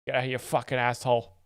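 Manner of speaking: fast, loud East Coast greeting. Shown as a demonstration. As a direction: bark it out like a friendly East Coast hello. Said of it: "how people say hello on the East Coast".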